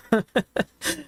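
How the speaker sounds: high, amused chuckle; real high-pitched laughter. Real, not acted. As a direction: laugh at your own joke. Laughing at own joke.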